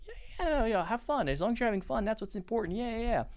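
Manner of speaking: high-pitched, encouraging mom voice; supportive mom. Retold or imitated. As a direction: cheer them on like a doting mother, voice lifted high. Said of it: "she was always supportive. She's like".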